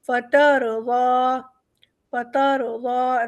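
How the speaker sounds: فترضا slow, ra full and thick; heavy ra. Shown as a demonstration. Teacher demonstrates a heavy ra. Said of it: "را ہمارا موٹا ہے".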